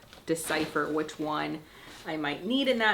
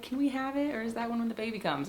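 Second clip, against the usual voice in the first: put-on kids asking; 6 semitones higher, 3 dB quieter, faster than usual. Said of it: "They're like".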